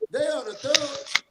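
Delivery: rising outcry. Real, not acted. Grieving relative on a radio show, rising to a loud outcry. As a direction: let the anger climb into a shout. Grieving family calling out gangs.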